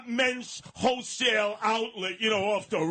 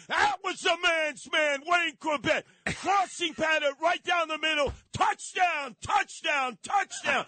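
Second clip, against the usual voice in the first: frantic mock sports commentary; 6 semitones higher than usual. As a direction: call it like a frantic play-by-play.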